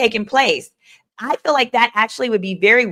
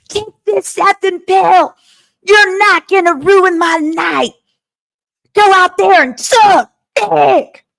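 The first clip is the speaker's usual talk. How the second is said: pitched way up and louder, a hammed-up rant; mock furious order; 10 semitones higher, 6 dB louder than usual. Retelling Jane's testimony, a high loud mock tirade. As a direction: bark the order like a bully, high and loud, slowing on the last line. Re-enacting quoted pill demand.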